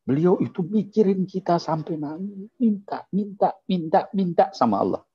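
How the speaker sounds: high, pleading and moved. Real, not acted. Preacher telling children the Prophet begged, moved and high. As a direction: let it rise as if near tears.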